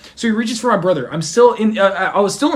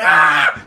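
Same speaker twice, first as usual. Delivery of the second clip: mock scream; 4 semitones lower, 4 dB louder than usual. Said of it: "And I was like".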